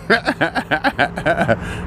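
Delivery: soft rolling chuckle; real chuckle. Real, not acted. Son amused by mimicking his mother, real chuckling. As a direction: chuckle away at your own impression. Laughs at his own impression.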